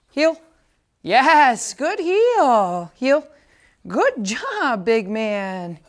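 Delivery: high, loud, singsong good heel; excited dog praise. Real, not acted. Trainer praising his puppy on the heel, high excited praise. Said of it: "using a lot more healing, good heel".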